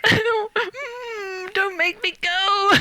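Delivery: hammed-up tearful whimper; mock whine. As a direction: beg like a child not to leave. Pleading not to leave set.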